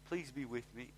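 soft, limp little plea; mock weak prayer. Shown as a demonstration. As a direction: make it sound faithless and small. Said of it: "Which one's he gonna answer?".

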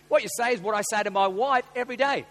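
ordinary talk, nothing marked

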